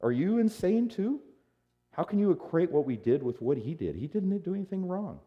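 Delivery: high-pitched, indignant questioning; incredulous rebuke. Acted. Felon in his right mind scolds the other, incredulous. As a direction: pitch it way up in disbelief. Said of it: "Basically, he's saying".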